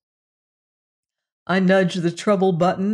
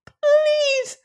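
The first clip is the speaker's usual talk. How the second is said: very high voiced squeak, falling; a tiny creature begging aloud; pitiful squeak. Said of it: "squeaked the little alien pitifully".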